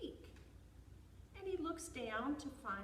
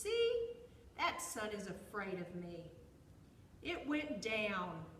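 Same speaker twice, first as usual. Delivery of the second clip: boastful bear; 7 dB louder than usual. A narrator's reading.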